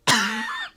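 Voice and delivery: acted coughing fit; hacking cough. Hobgoblin with a dry throat after shouting, hacking cough. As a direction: cough your liver up. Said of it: "like, coughing his liver up".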